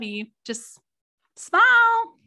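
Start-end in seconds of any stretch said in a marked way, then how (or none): 1.4-2.2 high, loud, bright smile; a cheery high smile for the friends she greets; playful smile. Real, not acted. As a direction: sing out the word smile at them. Playful instruction to viewers.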